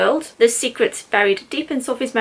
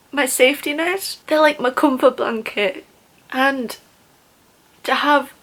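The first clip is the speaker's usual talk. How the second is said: getting emotional; 3 semitones higher, slower than usual. Real, not acted. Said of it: "I don't know why I'm getting so emotional".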